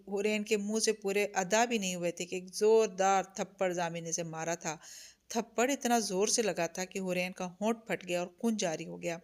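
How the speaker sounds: plain reading voice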